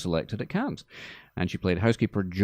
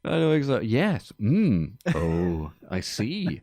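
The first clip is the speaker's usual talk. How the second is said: feigned knowing; 4 semitones higher, slower than usual. Acted. Mimics partner's feigned knowing responses.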